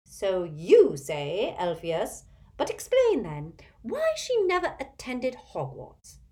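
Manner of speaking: high, swooping busybody character voice; gossipy imperious old aunt. A narrator's reading. Aunt quizzing Elphias about the biography, eager and overbearing. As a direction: relish the scandal with big pitch leaps.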